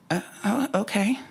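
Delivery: high, hesitant acceptance; tentative agreement. Retold or imitated. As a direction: stumble upward into an unsure okay. Tentatively accepting mother's advice.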